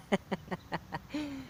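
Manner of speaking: soft, high little laugh; real laugh. Real, not acted. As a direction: chuckle quietly at your own joke. Laughs at own joke about Bridget.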